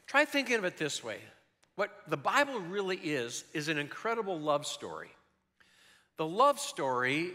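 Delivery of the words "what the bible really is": usual speaking voice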